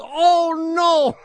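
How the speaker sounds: high, drawn-out cry of alarm; retold yell. Guest at a B and B realizes the toothpaste is wrong, mock yell. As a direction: yell it as in a comic story.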